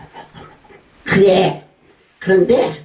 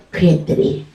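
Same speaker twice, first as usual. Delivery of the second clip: ordinary talk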